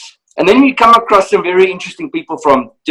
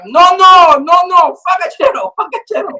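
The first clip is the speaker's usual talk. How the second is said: excited client yelling; 11 semitones higher than usual. Retold or imitated. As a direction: call out the word like he's spotted something. Client insisting, shouting.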